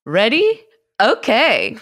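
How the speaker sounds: high, loud put-on voice; bright mock host voice asking if everyone's ready; silly put-on voice. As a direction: chirp it out high and loud, playing a bit. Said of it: "Don't talk like that".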